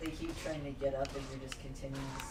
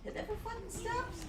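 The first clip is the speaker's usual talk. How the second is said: high, strained exasperated outburst. Real, not acted. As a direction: squeal it in frustration.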